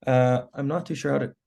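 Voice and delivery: scratchy, failing throat; hoarse voice. Real, not acted. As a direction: voice cracking from a cold. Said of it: "Sorry, my voice is going".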